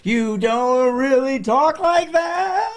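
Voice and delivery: high, slow, drawn-out ghostly moan; spooky ghost voice. Pretend. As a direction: wail it like a haunting ghost. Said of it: "But it's spookier".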